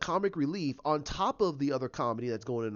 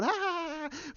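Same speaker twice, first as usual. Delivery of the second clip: Harley mock laugh; 13 semitones higher than usual. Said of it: "she's sitting there going".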